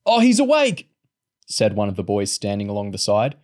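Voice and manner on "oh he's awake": high, loud exclamation; startled cry. A narrator's reading. Boy seeing the sick man wake, startled. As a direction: call out in sudden surprise.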